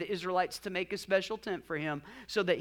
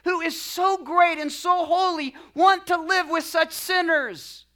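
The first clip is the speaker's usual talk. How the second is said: raised, emphatic reading of heartfelt questions; passionate reading; 5 semitones higher, 9 dB louder than usual. Acted. Preacher quoting a theologian's questions to congregation, impassioned. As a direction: read it with passion, stretching the opening words. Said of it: "he writes with a passionate heart".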